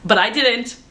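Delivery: normal talking voice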